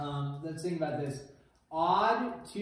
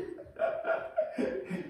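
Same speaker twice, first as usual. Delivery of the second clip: high airy laughter; dying of laughter; high breathless laugh. Real, not acted. Laughing at the recording.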